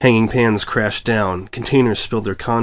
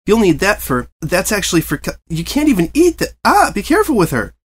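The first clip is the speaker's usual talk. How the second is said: flustered coaching; 9 semitones higher, 3 dB louder than usual. A narrator's reading. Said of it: "tried to coach from the sideline".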